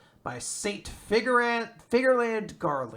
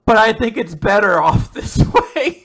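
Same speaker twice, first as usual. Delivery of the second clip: a louder retold line breaking into a laugh; dad's line through laughter; 6 semitones higher, 11 dB louder than usual. Retelling the dad's words to a friend, laughing. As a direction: laugh while quoting the grim dad. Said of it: "his dad's just like".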